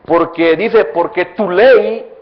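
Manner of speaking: loud, rising preacher's cry; preaching at full force. Acted. Preacher to congregation, loud and fervent. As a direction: drive the point home to the congregation.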